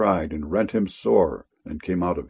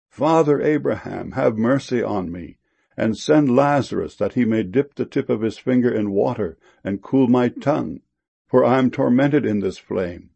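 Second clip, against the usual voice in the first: ordinary talk, nothing marked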